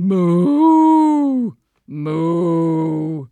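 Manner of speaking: drawn-out cow moos. Acted. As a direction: moo like a cow. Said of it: "adding a few moos".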